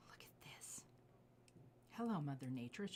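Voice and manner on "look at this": hushed, breathy awe over the jade; whisper. Real, not acted. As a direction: whisper it like a secret treasure. Admiring jade close to mic.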